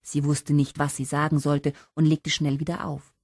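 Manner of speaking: usual speaking voice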